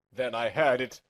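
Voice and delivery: ordinary delivery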